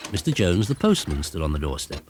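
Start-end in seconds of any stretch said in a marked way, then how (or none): none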